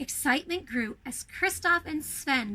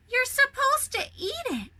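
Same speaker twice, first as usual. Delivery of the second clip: put-on child voice; 10 semitones higher than usual. A narrator's reading. Boy corrects Olaf.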